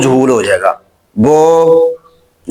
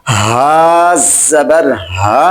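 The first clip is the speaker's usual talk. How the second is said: हा जबर हा, each vowel stretched long; slow drawn-out reading; 3 semitones lower, much slower than usual. Shown as a demonstration. Teacher modelling the correct unhurried reading. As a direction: draw the sounds out slowly. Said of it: "जल्दी के साथ इनको लंबा करना नहीं".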